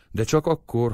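usual speaking voice

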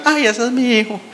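high-pitched mock outburst; imitating a student. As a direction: quote her in a high, airy exclamation. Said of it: "como decía una, una muchacha en un examen".

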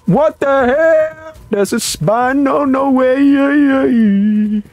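shrill high-pitched shocked cry, dragged out; startled shriek. Real, not acted. Gamer spotting a monster, shrieking in shock. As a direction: recoil in horror at what you see.

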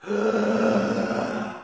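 sharp high gasp for air; mock gasp. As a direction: gasp like surfacing from drowning. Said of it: "take these breaths in".

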